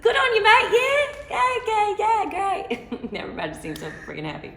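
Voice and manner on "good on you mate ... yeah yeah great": very high, loud mock Australian; Australian accent attempt. Singer imitates happy locals in Surfer's Paradise, exaggerated Australian accent. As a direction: gush like an over-happy Australian mate. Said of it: "can't even do an accent".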